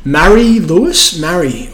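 ordinary talk

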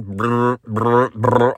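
low rolled vibrating burr; lip-buzzed phone vibration; imitated phone buzz. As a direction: mimic a phone buzzing over and over. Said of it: "phone was just a buzzing".

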